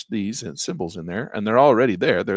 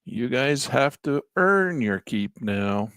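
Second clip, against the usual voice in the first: halting, slow raised voice that stammers on each uh; Robert Kennedy impression; 5 semitones higher, slower than usual. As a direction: stammer it out slowly like a shaky old politician. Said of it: "old Robert Kennedy got in there and said".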